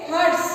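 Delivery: Harsh said loud and firm; Harsh stressed for the class; emphasized example word. Shown as a demonstration. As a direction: punch Harsh out like a teacher. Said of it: "जैसे".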